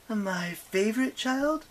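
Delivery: high, slow questioning voice; goddess in dialogue. A narrator's reading. Nyx asking about her children, high and drawn out.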